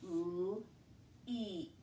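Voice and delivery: L-eat softly with a long ee; long vowel stretched. Shown as a demonstration. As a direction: let the ee say its name in L-eat. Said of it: "make that vowel say its name".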